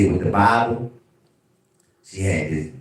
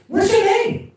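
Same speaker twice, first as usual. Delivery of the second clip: high, louder mock woman insisting; insistent woman caller; 4 dB louder, slower than usual. Woman caller insisting on his name. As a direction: push the question like a pushy caller.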